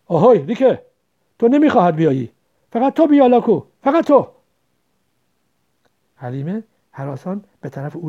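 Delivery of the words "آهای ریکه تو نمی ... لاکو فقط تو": very loud, domineering shout; roaring order. A narrator's reading. Headman's son singling out the girl, roaring order. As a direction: bellow at them to obey. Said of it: "پسر کدخدا غرید".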